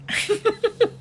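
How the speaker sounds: shrill mocking cackle; high laugh. Real, not acted. Taunting the reporter with a high laugh.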